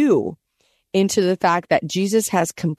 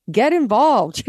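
mock-panicked plea, higher; playing a frantic disciple pleading; 5 semitones higher, slower than usual. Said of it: "So the disciples are like".

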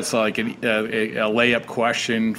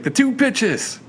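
imitated yell; 10 semitones higher, much slower than usual. Podcast host imitating the manager's yell to his team, mock yelling. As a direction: mimic a yell with the pitch jumped way up. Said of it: "but, I mean, he yelled".

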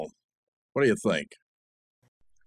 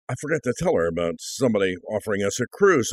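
usual speaking voice

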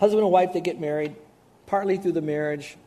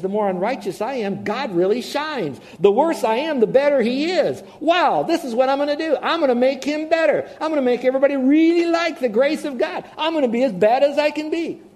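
gleeful mock sinner; 9 semitones higher, 6 dB louder than usual.

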